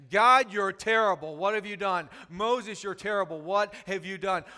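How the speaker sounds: loud mock accusation at God; hammed-up whine of blame; grumbling complaint. Israelites to God and Moses, a mock angry complaint.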